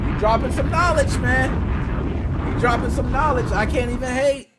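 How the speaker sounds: loud, high praise; loud impressed praise. Real, not acted. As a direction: hype him up for dropping knowledge. Impressed by the blogger's apology.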